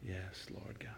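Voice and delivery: breathy whisper to the Lord; soft whispered amen to God; whispered prayer. Acted.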